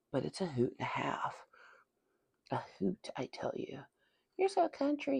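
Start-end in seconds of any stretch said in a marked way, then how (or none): 4.4-5.2 high-pitched imitation of a teaser; mock teasing remark. Voicing people's remark on accent.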